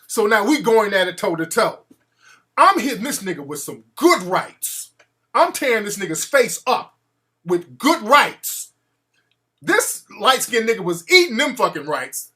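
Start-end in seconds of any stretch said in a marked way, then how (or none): none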